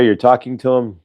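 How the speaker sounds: usual speaking voice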